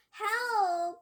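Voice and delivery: high, loud scream for help; terrified scream. Acted. Trapped boy screaming for help in high, loud terror. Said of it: "I began to scream".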